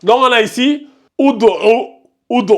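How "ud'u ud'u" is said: "ud'u" lu avec un ou initial; lecture avec ou initial. Shown as a demonstration. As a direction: prononce "ud'u" en commençant par le son ou. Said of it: "ça se lit ou". ("ud'u" articulé à l'arabe, ou au début)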